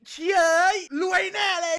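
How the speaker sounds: loud, high whoop of glee; excited outburst. Retold or imitated. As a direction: blurt it out like you just got rich. Excited outburst about money.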